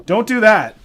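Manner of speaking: sudden sharp cry; exclaiming. Real, not acted. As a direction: blurt it out as something goes wrong. Something slips while working.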